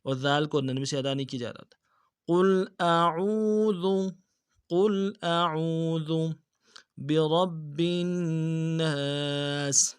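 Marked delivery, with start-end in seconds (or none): 2.3-10.0 slow melodic recitation; Quran recitation. Shown as a demonstration. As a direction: chant the verse slowly with tajweed. Recites verse after correction. (آہستہ سریلی تلاوت)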